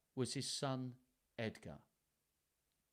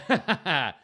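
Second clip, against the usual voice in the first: short, loud burst of laughter; real laugh; 6 semitones higher, 12 dB louder than usual. Real, not acted. Reader laughs at a schoolroom joke, short loud laugh. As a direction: laugh out loud at your own aside.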